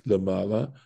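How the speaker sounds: ordinary talk, nothing marked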